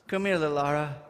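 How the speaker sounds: usual speaking voice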